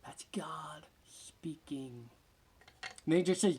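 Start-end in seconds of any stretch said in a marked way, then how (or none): 0.0-2.1 slow, soft unvoiced whisper; whispered aside. Real, not acted. Pastor to congregation after the quote, slow whisper. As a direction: breathe it out in awe.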